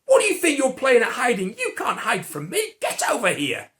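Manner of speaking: loud, laughing mock rebuke; hammed-up stern summons out of hiding; half-joking angry God calling the hiders out; mock angry scolding. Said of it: "and go".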